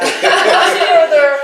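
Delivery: high, loud, slow words through a laugh. Real, not acted. Laughs at how far talk went.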